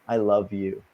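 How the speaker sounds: low, soft retold reassurance; tender. Telling an upset stranger he is loved, tender. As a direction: drop low and soft, as if disarming someone. Tells hostile stranger he loves him.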